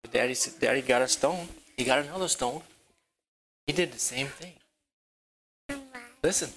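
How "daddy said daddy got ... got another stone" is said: ordinary delivery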